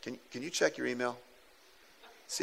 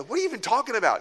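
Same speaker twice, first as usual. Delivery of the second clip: raised, incredulous question; disbelieving reply to the angry caller; baffled retort; 8 semitones higher, faster than usual. Retold or imitated. Pastor to the angry engineer, raised and incredulous. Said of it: "And I went".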